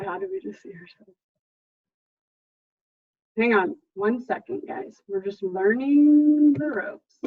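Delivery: ordinary talk, nothing marked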